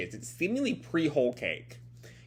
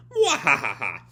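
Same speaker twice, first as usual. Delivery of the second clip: booming villain cackle; villain laugh; 4 dB louder than usual. Acted. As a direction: ham up an evil laugh. Queen laughs upside down.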